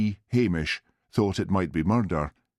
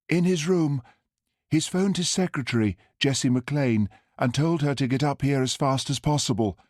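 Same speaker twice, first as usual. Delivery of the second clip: raised, lively female character voice; woman's voice; 7 semitones higher than usual. Acted. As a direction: voice the woman chatting brightly. Character voice in narration.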